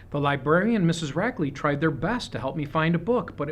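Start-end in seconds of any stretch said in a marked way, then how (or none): none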